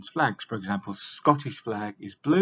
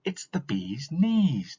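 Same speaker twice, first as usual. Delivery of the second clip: drawn-out saying; 5 semitones higher, slower than usual. Shown as a demonstration. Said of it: "Or we might say".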